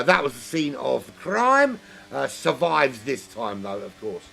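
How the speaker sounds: ordinary talk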